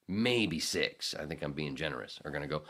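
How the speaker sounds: ordinary talk, nothing marked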